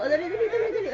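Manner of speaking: high, soft urging; excited coaxing. Real, not acted. Adult urging baby to keep going, high excited coaxing.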